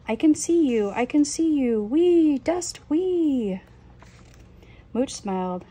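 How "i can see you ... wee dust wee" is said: high, loud delighted squeals; gleeful. Acted. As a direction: bounce with joy at the mess. Mooch greets his mess happily.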